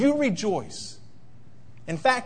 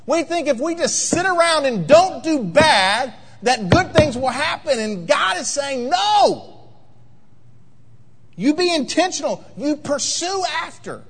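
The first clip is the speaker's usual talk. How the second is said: louder, higher, building to a ringing no; rising preacher fervor; 8 semitones higher, 4 dB louder than usual. Acted. Pastor rebukes passive saints, building to a loud no. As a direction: preach it up to a cry.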